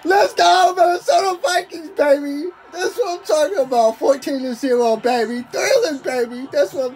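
high, loud triumphant yelling; excited shout. Real, not acted. Gamer cheering his team's lead, loud excited shouting. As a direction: roar it for the touchdown lead. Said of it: "I'm getting too excited".

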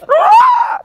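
piercing high goblin screech at full volume; shrieking goblin war cry; goblin scream. Retold or imitated. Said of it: "screaming top of my lungs".

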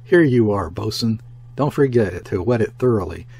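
ordinary delivery

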